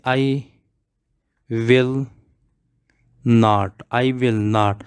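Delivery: I will not, spaced out word by word; slow model. Shown as a demonstration. Teacher models the negative for the class, very slow. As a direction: spell out I will not very slowly. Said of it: "तो negative में क्या है?".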